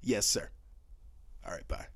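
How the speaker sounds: brief, polite professional sign-off. Acted.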